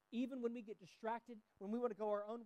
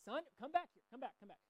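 calling son back; 5 semitones higher than usual. Retold or imitated. Dad calling son back on the road, raised call. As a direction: call out to a kid running ahead. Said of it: "I say".

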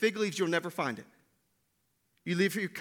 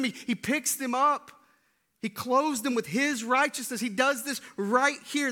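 full-force preaching; 7 semitones higher than usual. Acted. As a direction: drive the gospel point home. Preaches the gospel climax.

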